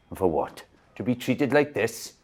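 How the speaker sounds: indignant complaint. Acted. Old soldier complaining loudly at the nurse, indignant. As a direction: build up to an outraged threat.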